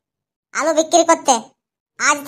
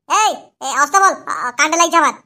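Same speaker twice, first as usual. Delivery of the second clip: ordinary delivery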